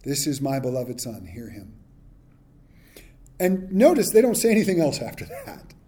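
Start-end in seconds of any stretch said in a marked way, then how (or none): 0.0-1.7 much softer, fading divine words; hushed voice of God. Acted. The voice of God to the disciples, soft and fading. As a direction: let it fall away softly and reverently. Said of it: "The voice of God speaks from heaven and says".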